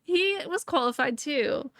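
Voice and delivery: said through lingering laughter; laughing. Real, not acted. Laughing with a co-host over a dark spoiler, amused.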